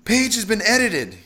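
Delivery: high, loud, mildly exasperated report; sighed report. A narrator's reading. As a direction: call it out with a touch of a sigh. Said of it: "he sighed".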